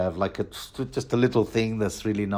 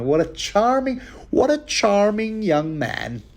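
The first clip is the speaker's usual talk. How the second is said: mock sweet lady; 11 semitones higher than usual. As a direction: gush it sarcastically in a high voice. Mocking Sansa's fake praise.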